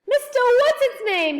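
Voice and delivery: high, loud delighted exclamation; excited cry. A narrator's reading. Saucepan man hearing of his old friend, crying out high. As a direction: cry the name out in surprise. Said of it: "he cried".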